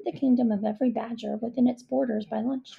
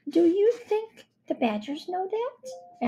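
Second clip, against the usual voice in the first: put-on high little girl voice; 9 semitones higher, slower than usual. A narrator's reading. Young girl character voice.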